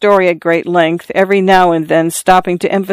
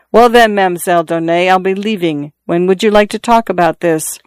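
ordinary delivery, nothing marked

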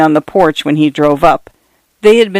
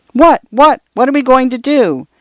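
put-on eager child; 8 semitones higher than usual. A narrator's reading. Daughter begs father for the day's plans, eager and high. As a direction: bounce with excitement for the answer.

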